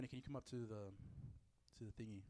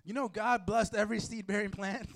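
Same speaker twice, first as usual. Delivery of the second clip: higher, louder stoner pleading his case; insistent stoner; 4 semitones higher, 5 dB louder than usual. Acted. Preacher playing a blunt smoker defending himself, worked-up stoner. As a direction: argue earnestly like a hammed-up pothead. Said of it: "you're gonna smoke a blunt or something".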